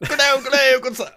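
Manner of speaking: high, hammed-up old English greeting; Victorian English gentleman. Gamer greeting good sir in roleplay, Victorian English gentleman. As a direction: put on a ye olde Victorian English voice. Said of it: "a ye olde English Victorian-y style".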